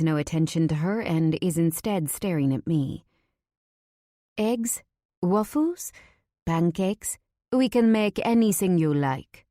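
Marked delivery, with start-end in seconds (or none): 4.4-9.5 put-on high-pitched French waitress accent. A narrator's reading. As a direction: offer the menu in a lilting French accent. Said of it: "That beautiful French accent".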